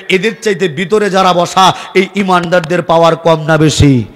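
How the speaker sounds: ordinary delivery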